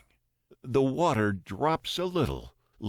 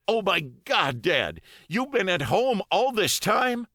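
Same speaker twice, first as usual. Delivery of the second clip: shocked son; 11 semitones higher, 3 dB louder than usual. A narrator's reading. Son shocked father stayed home.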